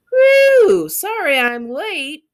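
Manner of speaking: high and loud, drawn out slowly; a loud, slow, breathless apology; loud breathless entrance. Acted. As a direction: burst in calling out, out of breath. Latecomer bursts in loudly.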